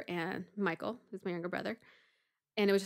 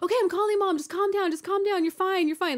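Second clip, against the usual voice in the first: high, loud, urgent calming; retold frantic reassurance; 11 semitones higher, 6 dB louder than usual. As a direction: act out panicky comforting of a sister.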